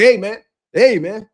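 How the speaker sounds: lightly caricatured boxing comedian greeting; boxing commentator impression. Mocking a boxing commentator who always talks like this, light caricature. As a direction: imitate a comedian's way of saying hello. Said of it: "comedian that always talks like this".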